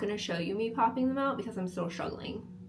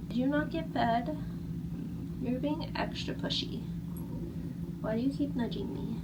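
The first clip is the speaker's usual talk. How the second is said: pet talk; 5 semitones higher than usual. Real, not acted. Talking to pushy pet.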